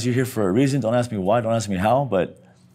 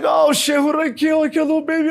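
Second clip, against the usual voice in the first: panicked yell; faster than usual. Retold or imitated. Patient realizing he may have killed someone, panicked. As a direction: blurt it out in sudden terror. Waking after the crash.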